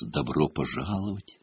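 soft, distant otherworldly voice; muffled unearthly voice. A narrator's reading. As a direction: speak like a faint voice from nowhere. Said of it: "приглушённый, почти неземной голос".

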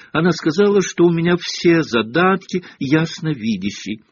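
high, gushing schoolgirl voice; high-pitched self-satisfied boasting; beaming with pride. A narrator's reading. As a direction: show off proudly, pitched high. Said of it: "сияя от гордости".

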